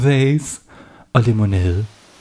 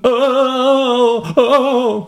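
high, hammed-up ow; badger yelping; 11 semitones higher than usual. A narrator's reading. Badger dipping toes in hot water, high yelp. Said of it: "råbte den".

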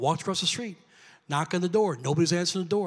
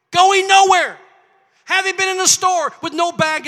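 rising excitement; 13 semitones higher, 11 dB louder than usual. Real, not acted. Preacher describes angel walking off.

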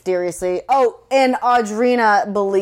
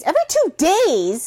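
incredulous; 8 semitones higher than usual. Real, not acted. Incredulous repeat.

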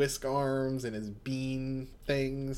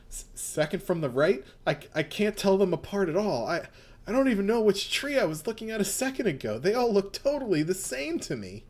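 agitated character; 6 semitones higher than usual. Acted. Voicing agitated Josuke.

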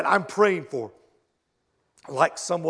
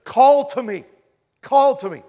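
loud, higher call from God; loud divine call; 6 semitones higher, 7 dB louder than usual. Retold or imitated. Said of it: "God says".

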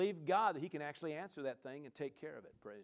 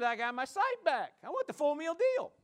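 high, loud excited declaration; triumphant answer; 10 semitones higher, 8 dB louder than usual. Retold or imitated. Beggar tells Jesus what he wants, high excited shout. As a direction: claim the whole blessing.

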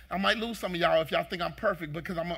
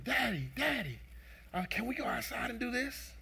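put-on higher, eager child asking Dad; lightly voiced excited little boy; happy young son; 7 semitones higher than usual. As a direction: imitate a son pleading to play. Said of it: "happy mood".

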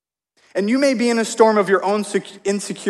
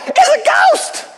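mock terrified shriek; 3 dB louder, slower than usual. Disciples see Jesus on the water, mock high-pitched terrified shriek. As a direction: squeal it out much higher, in mock panic. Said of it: "in their most masculine, like, voice they could, they cried out".

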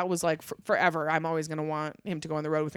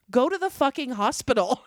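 mild mock yell, a bit higher; exasperated cop order; 7 semitones higher, 5 dB louder than usual. Young cop telling her to go to the hospital, mock yell. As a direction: bark it like a fed-up young cop. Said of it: "He goes".

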